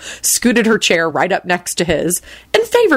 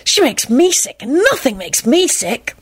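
disgusted; 7 semitones higher than usual. A narrator's reading. Tim disgusted.

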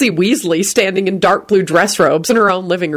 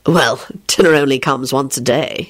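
low, matter-of-fact reply; truthful; 5 semitones lower than usual. A narrator's reading. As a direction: answer plainly in a lower voice. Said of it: "he replied truthfully".

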